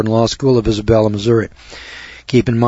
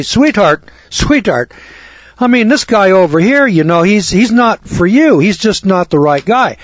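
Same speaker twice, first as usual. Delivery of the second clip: higher-pitched, lightly pleading dad; acted daddy persuading; 7 semitones higher, 3 dB louder than usual. Daddy talks his daughter out of a suitor, mildly pleading. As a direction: reason with her as a concerned father. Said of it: "that's what I am as a daddy".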